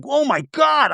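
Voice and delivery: high, shocked "oh my God"; shocked gasp. Retold or imitated. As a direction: gasp it out, scandalized. Reacting to sex scene.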